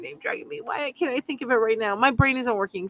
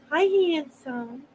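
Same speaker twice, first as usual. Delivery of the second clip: cooing to cat; 7 semitones higher than usual. Real, not acted. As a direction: coo sweetly at a beloved pet. Talking to her cat.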